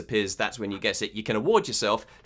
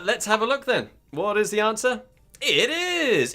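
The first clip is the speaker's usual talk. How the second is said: game-show reveal; 8 semitones higher, 3 dB louder than usual. Real, not acted. Quizmaster revealing the answer to players, game-show suspense. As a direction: tease the answer, pitch climbing high.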